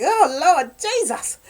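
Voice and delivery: scandalized gasp at the page, hammed up; mock shock. Reader shocked by a steamy scene, hammed-up disbelief. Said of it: "you're just like".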